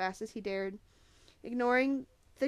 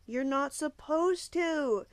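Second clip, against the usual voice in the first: put-on high, loud, drawn-out young boy; younger boy; 6 semitones higher, 6 dB louder, much slower than usual. A narrator's reading. Chuck warning Thomas off the stairs, high-pitched worried younger boy. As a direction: plead slowly in a boy's raised voice. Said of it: "the younger boy said".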